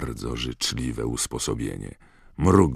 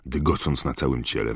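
ordinary delivery, nothing marked

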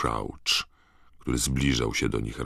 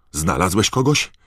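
high, loud incredulous question; raised, astonished shout; disbelieving call; 6 semitones higher, 7 dB louder than usual. A narrator's reading. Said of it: "zawołał pan Crouch z jawnym niedowierzaniem".

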